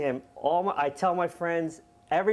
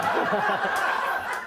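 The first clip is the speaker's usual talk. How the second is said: breathy laugh; 4 semitones higher than usual. Real, not acted. Laughing at own story.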